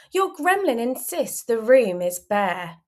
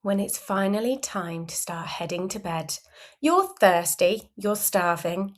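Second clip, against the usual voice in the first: plain reading voice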